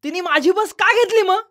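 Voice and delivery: high shouted protest; child shouting back. Acted. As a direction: yell back at mother, a bit hurt. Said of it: "यश ओरडला". (आईला उलट ओरडून विचारणारा मुलगा)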